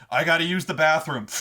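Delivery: loud call in passing; urgent bluff. Acted. Ridiculous guy runs past the guard, urgently calling out. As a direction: blurt it out loudly on the run. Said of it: "the guy runs past you like".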